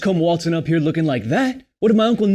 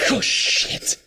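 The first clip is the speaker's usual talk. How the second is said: startled gasp; 6 semitones higher than usual. Acted. Startled by Ingrid's ambush.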